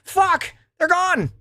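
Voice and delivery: high-pitched comic outcry; joking wail of sudden loss; mock dismay. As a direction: cry out in hammed-up dismay. Acts sudden loss of friends.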